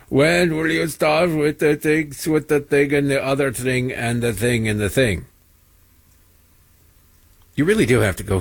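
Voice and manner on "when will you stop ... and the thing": put-on caricatured foreign accent, slowed down; mocking Sri Thanadar accent. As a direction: parody a vaguely Austrian accent, dragging the words. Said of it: "his accent is vaguely Austrian".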